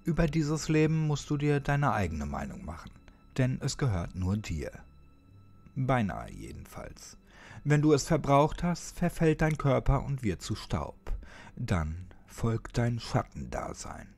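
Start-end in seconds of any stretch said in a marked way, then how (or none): none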